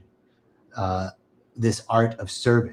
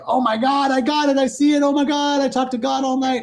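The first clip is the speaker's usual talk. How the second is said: much higher, elated cry of revelation; ecstatic breakthrough; 12 semitones higher than usual. Retold or imitated. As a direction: gush like someone who just saw God. Participants' ecstatic breakthrough, acted out.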